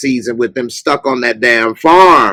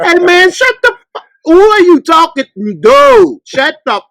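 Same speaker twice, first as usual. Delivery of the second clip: much higher, louder, half-laughing rant at the screen; exasperated yelling; 3 dB louder than usual. Retold or imitated. Ranting at people on the show, a much higher exasperated yell. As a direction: rant at the TV characters in disbelief. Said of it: "I be saying".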